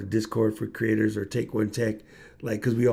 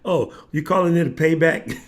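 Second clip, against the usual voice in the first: ordinary talk, nothing marked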